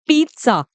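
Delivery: पिज़्ज़ा said "PEET-sa"; teacher's model पिज़्ज़ा, "peet-sah"; correct "pizza" with ts. Shown as a demonstration. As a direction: put a crisp t-s in पिज़्ज़ा, "pitsa". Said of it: "जो सही तरीका है इसको बोलने का".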